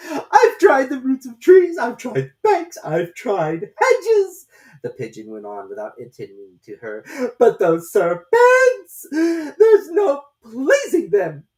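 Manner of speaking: very high, loud, slow and tearful bird voice; distressed pigeon. A narrator's reading. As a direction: wail like a harried mother bird.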